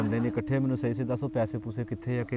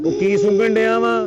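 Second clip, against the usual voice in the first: slow, higher, louder put-on voice; mock sniffing question; 8 semitones higher, 8 dB louder, slower than usual. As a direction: drag it out in a comic questioning voice.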